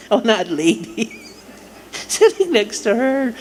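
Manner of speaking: story told through real laughter; can't stop giggling as you tell it; laughing while telling. Real, not acted. Retelling mom's church laugh.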